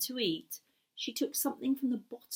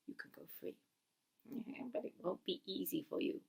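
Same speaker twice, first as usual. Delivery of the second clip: breathy sneering whisper; sly whispered aside; 4 semitones lower, 14 dB quieter, faster than usual. Acted. Wizard sneering at Gareth as he leaves, whispered. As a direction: hiss the promise softly, mocking.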